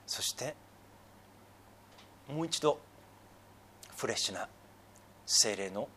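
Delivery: soft, low praying voice with long pauses; solemn prayer. Real, not acted. Pastor praying to the Father in repentance, hushed prayer. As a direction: pray quietly, pausing between short phrases.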